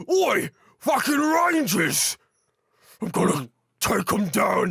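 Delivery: slow, loud orc shout; hammed-up orc war cry; orcish low voice. Shown as a demonstration. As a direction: bellow like a brutish orc. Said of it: "it's more of an orcish low".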